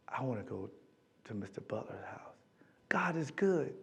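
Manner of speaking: a quiet awed aside about God's hand; reverent whisper. Retold or imitated. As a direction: whisper it like a secret blessing. Reverent hush about divine guidance.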